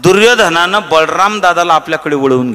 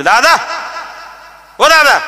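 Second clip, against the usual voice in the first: calling out; 13 semitones higher than usual. Retold or imitated. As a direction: shout for the elder brother from the door. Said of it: "सात्यकीनं हाक मारली".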